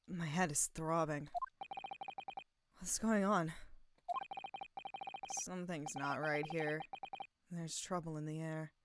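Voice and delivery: softly voiced hero with a throbbing head; low-key, quiet waking mumble; groggy game hero. Player voicing a game hero waking with a throbbing head, groggy and quiet.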